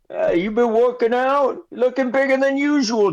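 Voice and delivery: high, chummy merchant voice; flattering shopkeeper. Acted. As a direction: flatter the customer. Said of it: "shopkeeper flatters Jilba".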